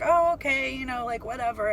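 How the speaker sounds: mock-agreeable "whatever"; dismissive humoring. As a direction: play along, then wave it away. Acted brush-off reply to his claims.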